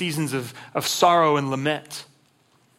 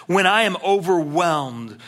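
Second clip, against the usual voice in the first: slow read; slower than usual. Shown as a demonstration. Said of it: "look at this next verse".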